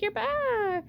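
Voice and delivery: high bright cheer of surprise; excited greeting. Retold or imitated. Brother-in-law greets her return, excited high cheer. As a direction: call out glad she's back.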